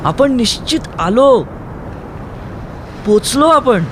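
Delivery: elated shout. Acted. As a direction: yell the good news over the storm. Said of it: "ओरडून त्याने कौमुदीला सांगितलं".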